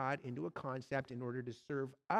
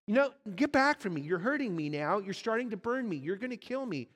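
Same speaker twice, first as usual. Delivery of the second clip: higher, louder and fast, recoiling in pain; panicked ghost; 5 semitones higher, 4 dB louder than usual. Acted. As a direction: push the angel away, scared.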